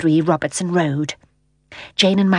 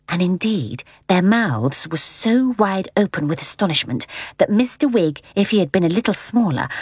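usual speaking voice

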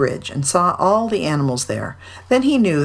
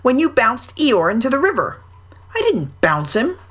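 high, bouncy Tigger; lively, pitched-up Tigger; 8 semitones higher than usual. A narrator's reading. Tigger pleads innocence.

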